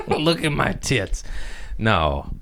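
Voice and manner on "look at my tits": laughing. Real, not acted. Jokingly shows off his sweater.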